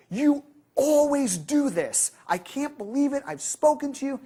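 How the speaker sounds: arguing partner. Retold or imitated. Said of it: "having an argument with their significant other".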